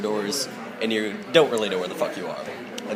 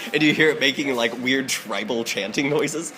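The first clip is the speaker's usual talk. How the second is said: usual speaking voice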